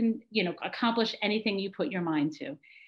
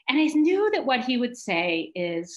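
usual speaking voice

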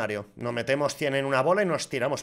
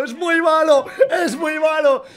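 high, loud mock yell; imitated shout; 11 semitones higher, 7 dB louder, slower than usual. Retelling a streamer's celebration, high loud mock yell. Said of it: "está gritando".

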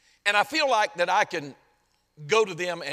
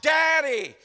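high, loud cry to a father; 9 semitones higher, 5 dB louder than usual. Acted. Preacher crying out to God as Daddy, loud and high. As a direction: call out with full voice, pitched way up. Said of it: "we can cry out".

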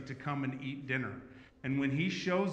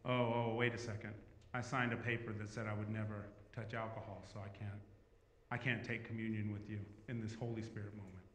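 soft, lower imitation of reluctant pastors; hesitant refusal; 5 semitones lower, 8 dB quieter than usual. Pastors declining communion wine with the group, hesitant and hushed. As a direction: back out quietly and haltingly.

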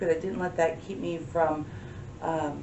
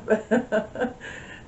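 soft laugh; 4 semitones higher than usual. Real, not acted. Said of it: "that made me laugh".

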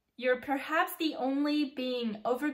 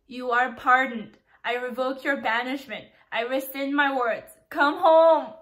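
building emotion; 8 dB louder than usual. Real, not acted. Fan reading the climactic letter, building to an excited cry. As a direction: let it swell until it breaks out.

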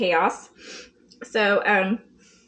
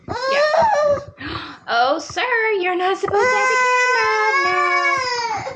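high shocked cry; high startled mock scolding; surprised exclaim; 14 semitones higher, 4 dB louder, slower than usual. Real, not acted. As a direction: gasp and protest. Child grabs the camera.

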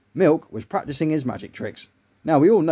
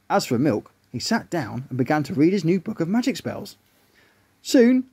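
usual speaking voice

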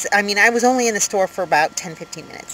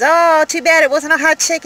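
higher, louder mock "too bad"; playfully exaggerated regret, pitched up and louder; mock-regretful quip; 9 semitones higher, 5 dB louder than usual. Retells own quip to stranger.

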